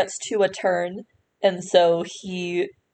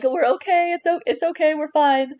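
imitated calling out; 7 semitones higher than usual. Game master echoes players calling from below, imitated calling out. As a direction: call it out lightly in a raised pitch. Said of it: "it's as you're calling".

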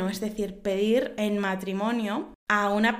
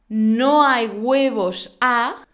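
mocking dare; 2 semitones higher, 7 dB louder, much slower than usual. Chiste: reto de no hay huevos.

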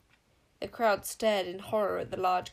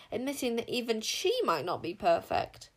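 plain reading voice

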